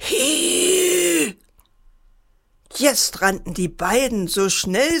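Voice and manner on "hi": long, very high "hiii" screech; eerie screech. A narrator's reading. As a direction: imitate a spooky creature with a drawn-out squeal. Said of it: "eine schreckliche Stimme sagte".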